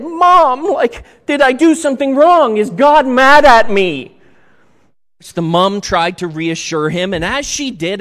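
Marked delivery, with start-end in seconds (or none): 0.0-4.2 put-on high, loud, tearful little boy; mock-crying kid asking mom if he did wrong; crying child. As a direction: plead as a hurt child near tears. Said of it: "cried and said".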